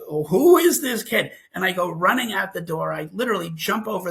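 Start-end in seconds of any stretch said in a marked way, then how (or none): 0.1-1.3 loud, slow, higher challenge; retold outraged dad confronting an intruder; protective father. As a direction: draw out the alarmed demand. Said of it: "The father kicks in".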